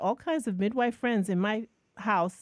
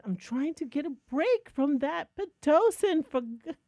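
exasperated outburst; 7 semitones higher than usual. Retold or imitated. In the bathroom, snapping at husband, exasperated. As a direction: vent like you're at your wit's end.

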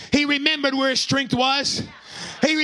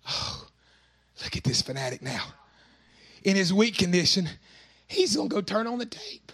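lower, softer scornful jeer; mocking crowd; 6 semitones lower, 4 dB quieter than usual. The crowd mocking Samson, a low scornful sneer. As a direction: sneer at the weak fanatic. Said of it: "laughing at him and saying".

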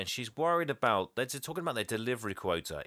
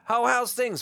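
bright, loud, high hello to his brother; mock cheery Santa on the phone; cheery greeting; 11 semitones higher, 5 dB louder, slower than usual. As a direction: greet him like an old friend. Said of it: "Santa is like".

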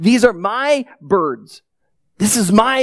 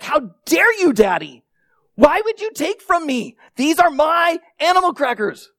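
higher-pitched mock outrage; indignant child; 7 semitones higher than usual. Toddler accusing Daddy of stealing animal crackers, mock indignant. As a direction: play a little kid affronted at Daddy.

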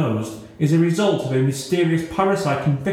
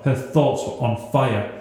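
usual speaking voice

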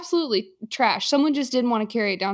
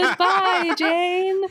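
high sing-song farewell; 9 semitones higher, 3 dB louder, much slower than usual. Retold or imitated. Personified red flags waving.

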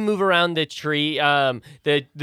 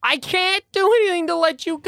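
high snake denial; 6 dB louder, faster than usual. Acted. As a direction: stretch it out in a shrill snake voice.

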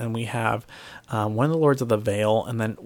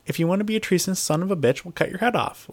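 higher, forceful commanding snap; mock Stark command; 7 semitones higher than usual. S0 voicing a Stark ordering a lord to kneel, hammed-up stern. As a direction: lay down the law like a Stark. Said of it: "It's like".

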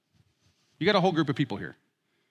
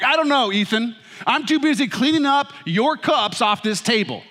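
higher, louder exasperated dad; theatrical busy-dad gripe; mock dramatic complaint; 7 semitones higher than usual. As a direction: ham up the put-upon father. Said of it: "very loudly and dramatically".

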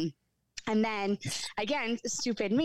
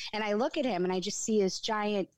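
ordinary talk, nothing marked

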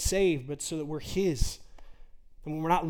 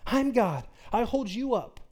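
God, incredulous; 8 semitones higher than usual. Acted. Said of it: "And he says".

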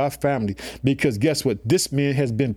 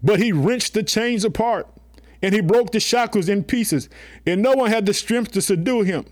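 raised, ringing Bible reading; scripture reading; 6 semitones higher than usual. Acted. Preacher reading scripture to the church, raised and ringing. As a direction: read the verse up high to the congregation.

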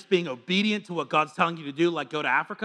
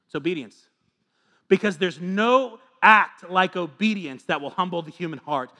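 ordinary talk, nothing marked

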